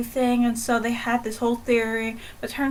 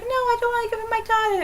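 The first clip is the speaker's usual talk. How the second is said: quoted mother; 11 semitones higher, faster than usual. Retold or imitated. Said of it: "the mother's like".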